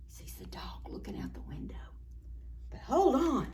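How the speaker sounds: soft fast whisper. A narrator's reading. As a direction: breathe it quietly. Whispered story line.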